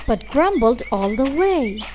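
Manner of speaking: plain reading voice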